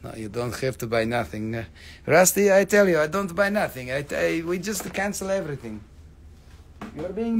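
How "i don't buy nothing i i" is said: ordinary talk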